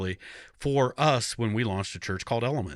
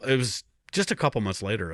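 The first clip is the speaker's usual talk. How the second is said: usual speaking voice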